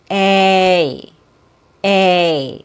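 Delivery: A drawn out long, jaw dropping then rising; slow long vowel A; long A demo. Shown as a demonstration. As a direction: stretch the A in two steps. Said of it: "It's a long vowel sound".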